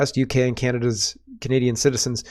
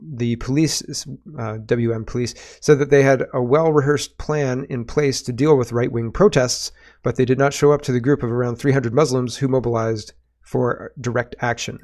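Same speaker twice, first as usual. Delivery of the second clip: usual speaking voice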